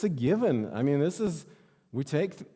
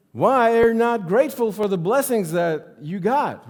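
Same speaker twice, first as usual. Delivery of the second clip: high, loud preaching push; full-force preaching; 9 semitones higher, 7 dB louder than usual. Acted. Preacher pressing the congregation to be grateful, loud and high. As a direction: drive the point home to the congregation.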